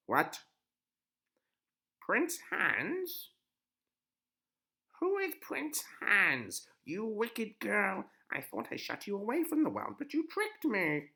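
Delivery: high, loud, slow furious crone; a witch outraged at the girl's trick; very high, loud witch, seething; angry witch. Acted.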